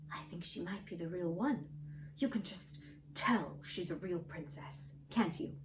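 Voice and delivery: soft, hushed secret to his mother; a quiet murmur so no one else hears; whispering. A narrator's reading.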